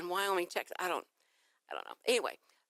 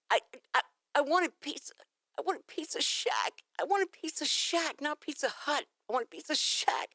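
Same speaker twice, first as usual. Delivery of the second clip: whiny frustration; 7 semitones higher than usual. Retold or imitated. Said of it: "frustrated caller".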